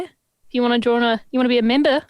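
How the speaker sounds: hammed-up Australian accent; mock Australian, dropping the r's. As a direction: put on a bad Aussie accent. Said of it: "the worst accent ever".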